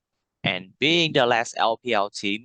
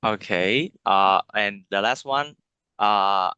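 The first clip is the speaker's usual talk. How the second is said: ordinary talk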